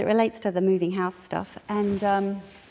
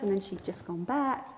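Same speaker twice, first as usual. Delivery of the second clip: ordinary talk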